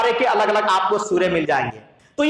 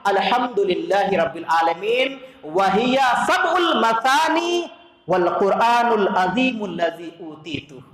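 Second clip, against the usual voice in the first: recitation; 4 semitones lower than usual. Acted. Recites Arabic hadith words.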